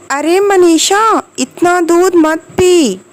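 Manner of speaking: high, drawn-out exclamation of astonishment; exclaiming in surprise. Shown as a demonstration. Teacher demonstrating the exclamation mark to students, surprised exclamation. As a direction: stretch it out, pitched well up, as a demonstrated exclamation. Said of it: "विस्मय, आश्चर्य".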